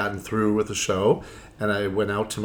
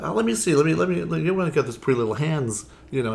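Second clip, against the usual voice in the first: teasing policeman; 7 semitones higher, faster than usual. Retold or imitated. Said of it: "he's like".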